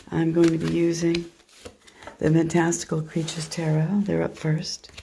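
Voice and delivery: normal talking voice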